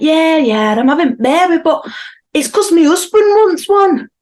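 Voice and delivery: put-on high, loud, slow little girl voice; child voice. Mum imitating her daughter's cheeky reply in a high voice. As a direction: play the cheeky toddler.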